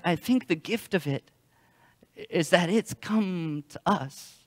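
ordinary talk, nothing marked

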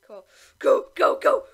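loud urgent chant; frantic cheering. Real, not acted. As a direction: urge it on, loud. Urging herself to win.

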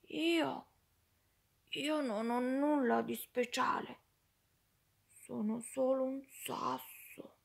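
fading from plain to a sad whisper; sad little stone. Acted. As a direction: shrink into nothing by the end. Stone feels worthless, self-pity. (il sasso triste, sussurrando alla fine)

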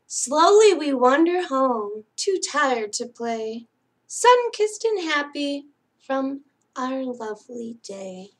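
singsong picture-book reading; bedtime story reading. Acted. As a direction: read it like a lullaby to sleepy kids. Reads bedtime picture book aloud.

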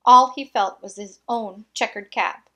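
ordinary delivery, nothing marked

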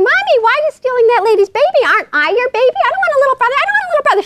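put-on high, rushed child's whine; protesting little kid. Little kid objecting to mommy stealing a baby, mock whining. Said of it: "the other one is going".

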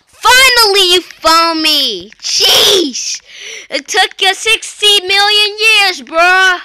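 high, loud relieved yelling; excited shout. Real, not acted. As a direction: shout it out, finally found. Finally found while hiding.

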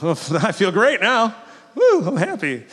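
mock the idea words fix grief; mock sudden cheer. Mocking comforted griever.

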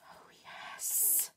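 ordinary talk

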